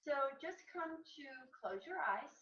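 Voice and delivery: usual speaking voice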